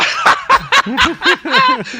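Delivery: loud, high burst of laughter; laughing at himself. Real, not acted. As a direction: burst out laughing at your own words. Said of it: "you're laughing at what you said".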